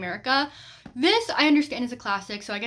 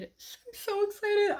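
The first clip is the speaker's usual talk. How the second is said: much higher, slow, squealed "excited"; squealing excitement; 11 semitones higher, slower than usual. Shown as a demonstration. Vlogger gushing to viewers about her next read, giddy high squeal. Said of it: "repeats then stretches it".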